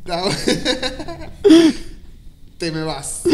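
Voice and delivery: bursting out laughing mid-word; cracking up, can't finish the line. Real, not acted. Laughing through the story.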